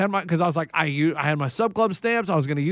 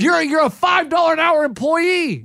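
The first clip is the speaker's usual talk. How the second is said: high, slow, shouted retold insult; angry put-down; 8 semitones higher, 4 dB louder than usual. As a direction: shout it down at the clerk, dragging the words. Acted angry put-down of the clerk.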